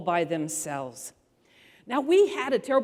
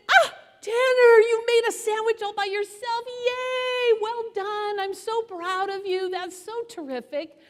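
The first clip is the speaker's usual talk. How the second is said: put-on much higher, bright praise for a toddler; gushing praise; 13 semitones higher than usual. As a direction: cheer him on like a proud mom. Mother praising toddler's sandwich.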